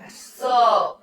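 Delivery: ordinary delivery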